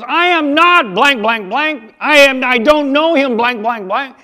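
furious shout, high and loud, swearing replaced by "blank"; Peter cursing. Retold or imitated. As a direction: Peter swearing he never knew him. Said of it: "And Peter goes".

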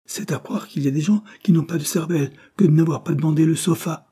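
angry. A narrator's reading. Servant scolding them for not asking for the sofa, angry. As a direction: scold with rising exasperation. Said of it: "cria-t-elle avec colère".

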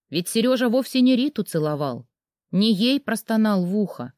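ordinary delivery

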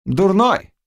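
high, exasperated exclamation; angry cry. A narrator's reading. Witch exasperated with the lovesick Cossack, high angry cry. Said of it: "сердито вскрикнула Гарпина".

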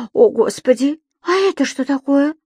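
secretary spotting the creature, startled; gasping surprise. Acted. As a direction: catch your breath at the sight. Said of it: "ахнула она".

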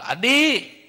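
put-on high, loud call to a boy; calling teacher. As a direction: shout his name from the bus. Teacher calls out to Adi. (memanggil dengan suara tinggi dan keras)

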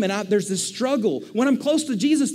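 ordinary delivery

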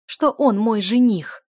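loud incredulous anger; angry challenge. A narrator's reading. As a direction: draw out each word in indignation. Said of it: "гневно поджимая губы".

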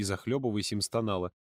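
usual speaking voice

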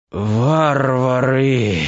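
slow, dying moan; groaning. A narrator's reading. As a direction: groan it out, near death. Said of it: "простонал раненый".

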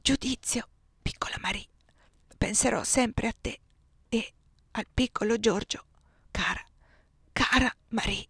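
tender, voiceless farewell whisper; dying whisper. Acted. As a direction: whisper goodbye with the last breath. Dying man's farewell to Marie. (sussurro d'addio di un moribondo)

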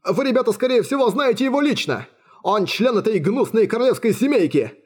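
high-pitched, nervous gabble; frightened captive's babbling; jabbering. A narrator's reading. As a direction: spill it all anxiously, voice up. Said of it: "затараторил пленник".

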